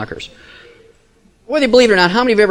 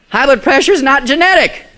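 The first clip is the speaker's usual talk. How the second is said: preaching proclamation; 8 semitones higher, 4 dB louder than usual. Real, not acted. Climactic repeated proclamation.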